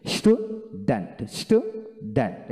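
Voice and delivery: Student split as stew, dent; slow teaching demo of student; syllable by syllable. Shown as a demonstration. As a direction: model student slowly in two parts. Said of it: "এইভাবে বলবেন".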